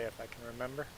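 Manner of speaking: ordinary talk, nothing marked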